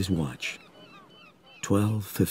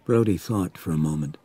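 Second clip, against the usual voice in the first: plain reading voice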